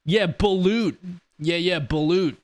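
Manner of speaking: balut said as "pa-LOOT", stress on the second syllable; pronouncing balut. Shown as a demonstration. Streamer shows chat how to say balut, in a clear demo voice. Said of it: "That's how you pronounce it".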